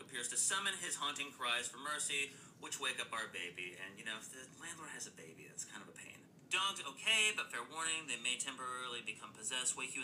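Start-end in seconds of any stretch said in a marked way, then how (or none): 3.8-6.2 softer, confiding aside; quiet aside. Acted. Podcast host whispering a joke aside to listeners. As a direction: drop to a hushed aside. Aside about landlord's baby.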